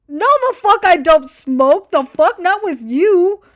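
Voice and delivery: high, loud, drawn-out refusal; outraged shout. Acted. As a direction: yell it back in mock outrage. Mock outraged reply to a DM.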